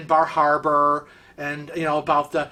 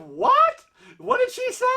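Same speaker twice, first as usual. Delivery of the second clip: baffled. Retold or imitated. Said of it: "He was like".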